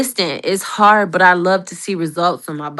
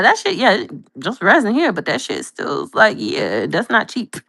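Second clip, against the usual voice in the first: ordinary delivery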